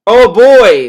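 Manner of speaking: loud fake cheer; sarcastic excitement.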